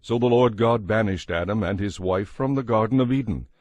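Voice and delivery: ordinary talk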